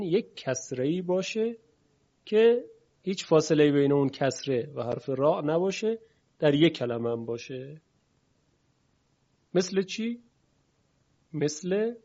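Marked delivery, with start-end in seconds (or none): none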